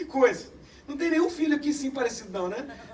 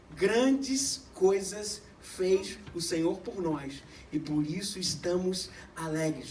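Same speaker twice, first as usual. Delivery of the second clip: lower, slow, soft reading of the verse; solemn scripture reading; 6 semitones lower, 5 dB quieter, much slower than usual. Acted. Preacher reading the psalm verse aloud, slow and solemn. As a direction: read it reverently, drawn out.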